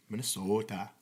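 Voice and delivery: put-on Minnesota with long rounded Minnesota accent vowels; mock Minnesota accent. Asked by a friend, trying out a mock Minnesota accent. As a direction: stretch Minnesota out in a jokey Minnesota accent. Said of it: "like, a Minnesota accent?".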